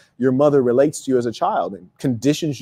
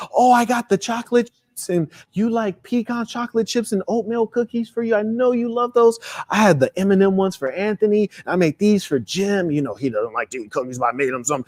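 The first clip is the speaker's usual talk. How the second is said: higher, sweet and fond; doting aunt; 4 semitones higher than usual. Retold or imitated. Aunt happily telling each kid what she baked. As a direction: list the cookies like gifts of love. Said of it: "She'd be like".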